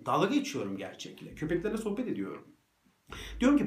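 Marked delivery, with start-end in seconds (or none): none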